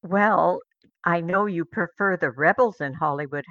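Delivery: usual speaking voice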